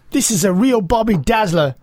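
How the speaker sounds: hammed-up British accent; putting on a cheesy British accent; mock British accent. American friend doing a mock British accent for the hosts. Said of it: "two British things I can really say in a British accent".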